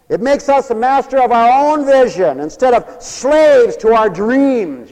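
loud, high sermon peak; full-force preaching. Acted. Preacher exhorting congregation to master their vision, full-force preaching. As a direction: proclaim it loudly to the whole church.

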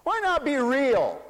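ordinary delivery

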